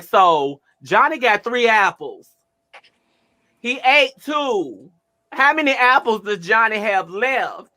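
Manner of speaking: high, slow, over-emphatic math question; mock-stern loud tutoring; loud teacher voice. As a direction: spell out each number like scolding. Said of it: "I wasn't sounding like".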